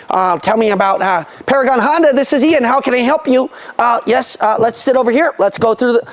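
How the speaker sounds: stiff salesman patter. Acted. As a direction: mimic a scripted, formal car salesman. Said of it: "not talking to you like this".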